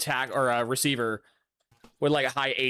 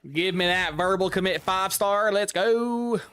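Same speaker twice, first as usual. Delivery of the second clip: a hammed-up Southern twang, higher pitch; southern accent; 6 semitones higher, 3 dB louder than usual. Real, not acted. Streamer hyping a five-star commit, in a hammed-up Southern accent. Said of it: "developed a southern accent".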